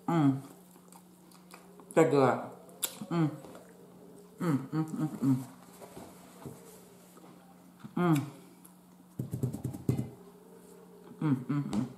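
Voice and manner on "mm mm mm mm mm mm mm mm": savoring mmm. Real, not acted. Savoring food on camera.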